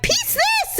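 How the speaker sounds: very high-pitched comic attack yell; mock battle cry. Woman imagines killing the mantis, mock battle cry. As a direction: squeal it as you strike.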